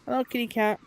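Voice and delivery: high, sing-song hello to a kitty; cooing pet voice. Real, not acted. Greeting a cat while exploring the village, high cooing. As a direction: coo at the cat like a pet owner.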